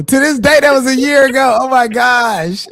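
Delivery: high, loud and laughing; squealing at the memory; excited squeal. Real, not acted. Laughing with the host about the old roast, high and loud.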